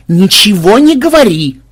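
high, loud plea for silence; exasperated command. A narrator's reading. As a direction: shut down any comment at once. Irritably silencing a companion.

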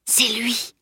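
urgent stage whisper. A narrator's reading. Salim pointing out the idler to his friend, whispering. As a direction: whisper it to point someone out secretly. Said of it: "souffla Salim".

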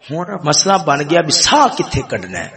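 ordinary talk

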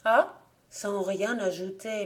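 usual speaking voice

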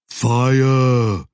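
high, loud creature bellow; a troll insisting with one word; loud troll shout. Acted. Troll urging the rescue.

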